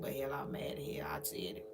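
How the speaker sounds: a sneaky voiceless aside; whispering the confession low; whispered aside. Retold or imitated. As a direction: whisper it under the breath. Acted quote of herself, dropping off.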